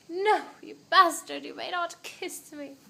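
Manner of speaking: dramatic movie. Acted. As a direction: overact like a movie star refusing a kiss. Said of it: "like a dramatic movie".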